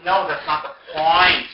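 loud, slow, exasperated correction; firm retort. Retold or imitated. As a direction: push back loudly, stretching the words. Arguing back in acted quarrel.

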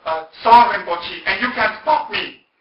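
loud, high, drawn-out imitation of indignation; very indignant. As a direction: declare it defiantly, acting her out. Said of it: "then she became very indignant".

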